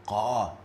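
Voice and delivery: 'Qa' heavy, with no smile. Shown as a demonstration. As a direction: drop the smile for a dark 'Qa'. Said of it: "There's no smile".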